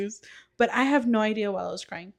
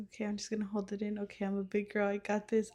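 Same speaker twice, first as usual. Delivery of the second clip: hushed self pep talk; quick, soft muttered reassurance; holding it in; 10 dB quieter, faster than usual. Retold or imitated. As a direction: talk yourself down under your breath. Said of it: "I was, like, holding it in".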